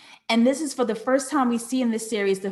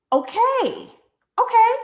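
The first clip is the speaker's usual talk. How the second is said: excited okay; 12 semitones higher, 4 dB louder than usual. Real, not acted. Excited reaction to a comment.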